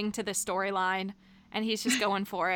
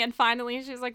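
ordinary talk, nothing marked

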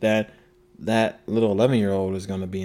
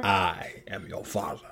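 Darth Vader line; 4 semitones lower, 8 dB quieter, slower than usual. Retold or imitated. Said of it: "a deep James Earl Jones kind of voice".

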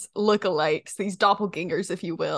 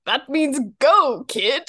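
louder, higher, slow impatient explanation; gruff herald; 4 semitones higher, 4 dB louder, slower than usual. Retold or imitated. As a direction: spell it out to the kid, exasperated. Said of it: "he goes".

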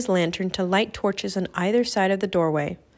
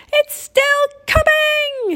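very high, loud screech; panicked shriek; 6 dB louder, slower than usual. A narrator's reading. Jack looks back at the chasing bone, panicked shriek. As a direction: shriek it in storybook terror. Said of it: "he shrieked".

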